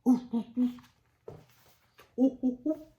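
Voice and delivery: loud, high monkey hoots; ape hoots. Acted. Ape hooting at Stanley from the tree. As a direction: hoot like an ape.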